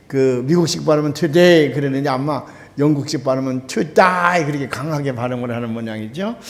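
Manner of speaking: ordinary talk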